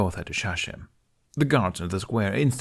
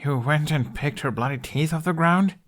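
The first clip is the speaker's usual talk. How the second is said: stammered; 7 semitones higher than usual. A narrator's reading. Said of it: "Hiccup stammered".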